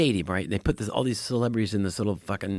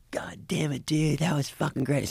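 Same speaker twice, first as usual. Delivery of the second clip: higher, hushed gushing fan; excited whisper; 4 semitones higher, 3 dB quieter than usual. Retold or imitated. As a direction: whisper it, thrilled. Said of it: "He's like".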